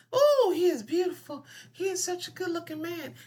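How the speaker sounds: very high-pitched mock swooning; exaggerated high gush of admiration; gushing admirer. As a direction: play an onlooker fawning over a man. Voices a whole room admiring him.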